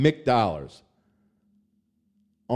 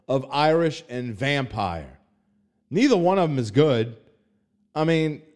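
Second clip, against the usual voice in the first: usual speaking voice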